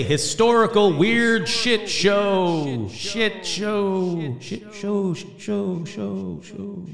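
big drawn-out title call fading into echoes; slow, booming segment intro with echo tail; echoing show announcement. Pretend.

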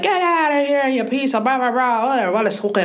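high-pitched imitation of a scolding mother; quarrelling mom. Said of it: "Mom would be like".

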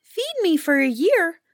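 high, piping bird voice; talking grouse. A narrator's reading. Grouse makes the hunter a promise.

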